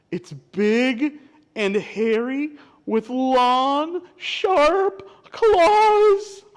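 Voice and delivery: slow build to high, loud dread; storybook suspense, climbing in pitch; dramatic scared description. Acted. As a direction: draw out each word, rising to a cry. Wolf describes the scary creature.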